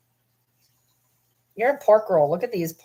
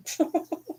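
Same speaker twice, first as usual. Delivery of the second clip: short high-pitched giggle; laugh; 11 semitones higher, 6 dB quieter than usual. Real, not acted. Laughs about the eaten couch.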